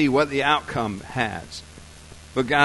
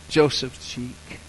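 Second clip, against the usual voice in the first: ordinary delivery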